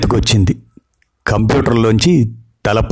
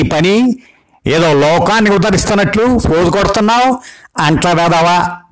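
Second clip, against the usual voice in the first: put-on higher, scolding old grandmother on a roll; ranting grandma; 9 semitones higher than usual. Grandma berates her grandson, high scolding old-woman voice. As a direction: heap insults like a fierce granny. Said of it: "అష్టోత్తర శతనామావళి మొదలుపెట్టేశారు".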